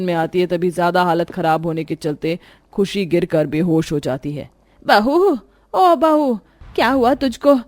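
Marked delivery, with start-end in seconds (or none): 4.8-7.5 worried call. Acted. Mother-in-law finds bahu fainted.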